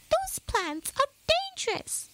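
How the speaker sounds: high mouse voice, cautioning; warning old mouse. A narrator's reading. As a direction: warn the group, squeaky elder. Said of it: "he warned".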